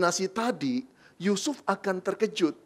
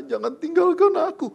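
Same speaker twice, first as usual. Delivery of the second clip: pleading; 10 semitones higher, 7 dB louder than usual. Acted. Mary begging.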